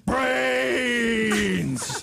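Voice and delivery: zombie shout. Acted. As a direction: cry it out like a hungry zombie. Zombie answers loudly.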